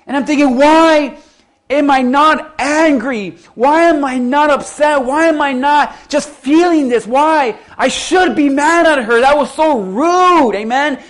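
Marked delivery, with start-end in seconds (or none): 0.6-10.5 loud, high, emphatic preaching; preaching at full force. Acted. Pastor crying out to the congregation in full preach. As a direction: hammer each question to the crowd.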